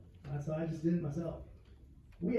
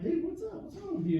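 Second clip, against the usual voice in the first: pitched way up, worried buddy; high concerned dude; 12 semitones higher than usual. Retold or imitated. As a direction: call out to a sad friend, high and caring. Comic voicing himself to sad friend.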